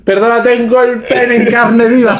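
high, slow, drawn-out protest; mock distressed patient. Friend playing the sore patient to the doctor, high mock protest. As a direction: plead it high-pitched with a laugh underneath.

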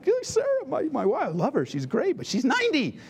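lightly voiced incredulous old man; husband fondly doubting his ninety-year-old wife; Abraham doubting. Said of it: "He's like".

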